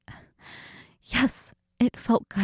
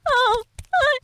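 higher, breaking moans between words; feminine moaning; 8 semitones higher than usual. Acted. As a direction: let the voice crack and moan on every phrase. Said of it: "the sound I'm making there, so, oh, feminine, the way I'm, oh, moaning for you".

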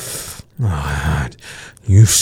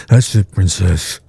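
ordinary delivery

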